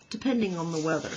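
fast throwaway tag; quick aside. Acted. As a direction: rattle it off quickly. Another goose repeats aside.

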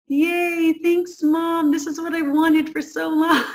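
put-on a kid pleased to get any attention at all; happy child. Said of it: "inside they're like".

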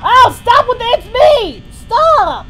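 loud frantic stop; yelling. Real, not acted. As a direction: shout it at the screen. Yelling at game jumpscare.

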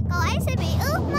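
fast, loud worried cry. Acted. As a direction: rush it out in panic for the teddy. Teddy left out in rain.

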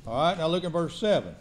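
ordinary talk, nothing marked

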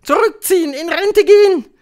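high, loud orders; thundering witch. A narrator's reading. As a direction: screech commands at your followers. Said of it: "donnerte die Hoch". (donnernde Befehle, hoch und laut)

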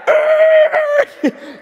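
high yelping laugh-shout of jolting awake; startled waking yelp. Retold or imitated. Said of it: "it's like".

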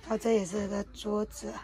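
usual speaking voice

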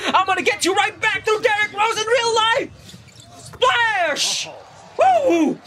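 high-pitched vengeful hype; gleeful yelling. Real, not acted. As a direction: shriek it in triumph, then whoop. Gleeful revenge as the shot drops.

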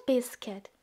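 ordinary delivery

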